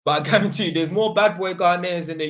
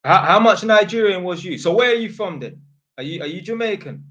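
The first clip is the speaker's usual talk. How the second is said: loud, higher mock questioning; mock chat questions; 5 semitones higher, 8 dB louder than usual. Streamer voicing imagined viewers quizzing him, loud and higher. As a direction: fire off the questions like a nosy viewer.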